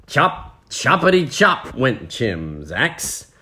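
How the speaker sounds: slow, punchy chopping rhythm; story narration. A narrator's reading. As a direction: make the axe strokes land. Reads the story aloud.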